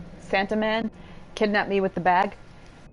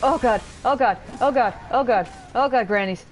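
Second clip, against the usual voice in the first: higher, louder panicked babbling; terrified gibbering; 5 semitones higher, 6 dB louder than usual. Real, not acted. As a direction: gabble it in rising terror. Fleeing the grannies again.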